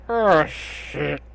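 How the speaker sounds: voiced dragon's growl of alarm; a growled monster's curse. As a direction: growl it as a startled beast. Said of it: "sort of growl".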